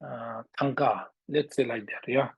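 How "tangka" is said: ordinary talk, nothing marked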